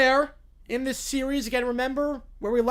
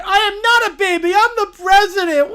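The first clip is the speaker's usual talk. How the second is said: higher, louder scream that breaks into a sob; crying tantrum scream; 9 semitones higher, 11 dB louder than usual. Acted. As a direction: wail like an offended child. The bear screams back.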